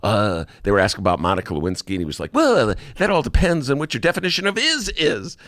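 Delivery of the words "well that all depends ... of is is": lightly voiced Clinton, higher, lawyerly; Bill Clinton imitation. Host doing a light Clinton deposition imitation, higher. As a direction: parse the word like a slick lawyer. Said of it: "my imitation of Bill Clinton".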